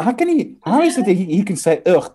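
ordinary talk, nothing marked

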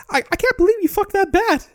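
very high, fast squeaky cartoon mouse; Mickey Mouse voice. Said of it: "And Mickey's like".

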